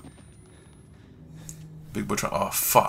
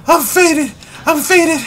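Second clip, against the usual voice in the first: high, crying-tinged repetition; distressed whimpering repeats; 10 semitones higher than usual. Real, not acted. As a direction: moan it over and over in distress.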